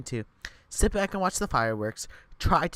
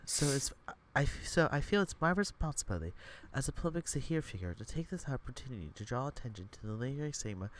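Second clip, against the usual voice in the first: softer, measured monk speech; monk reading a speech; 2 semitones lower, 9 dB quieter than usual. Acted. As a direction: read it like a solemn statement. Reads the monk's speech aloud.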